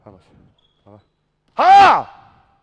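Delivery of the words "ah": very loud, high wordless shout; piercingly high real coach's yell; sudden yell. Real, not acted. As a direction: cut play short with a yell. Coach yells to stop play.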